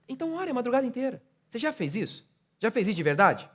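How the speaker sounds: high, shouted exhortation; preaching at full force. Acted. Pastor challenging his congregation to persevere, preaching at full force. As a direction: pastor daring the church to keep praying.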